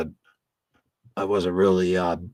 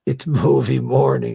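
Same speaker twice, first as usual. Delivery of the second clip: loud slow announcing; 6 semitones higher, 7 dB louder than usual. Real, not acted.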